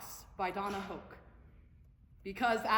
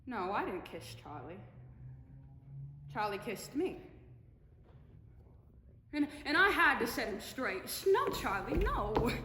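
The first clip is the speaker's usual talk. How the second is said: wistful film star reminiscing; Mabel Normand; 3 semitones lower than usual. Acted. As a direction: share a fond old secret, lightening toward the end. Mabel reminisces wistfully about Charlie.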